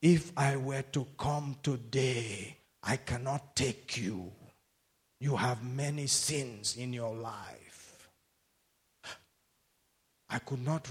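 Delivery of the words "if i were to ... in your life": lower, softer, slow solemn voice; voice of God. Retold or imitated. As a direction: speak God's verdict slowly and gravely. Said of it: "I heard a voice and it said to me".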